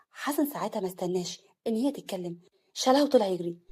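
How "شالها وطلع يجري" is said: plain reading voice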